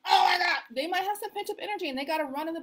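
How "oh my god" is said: loud, high exasperated cry; exasperated outburst. Retold or imitated. Worker fed up with a deaf boss, exasperated cry. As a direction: let the frustration burst out.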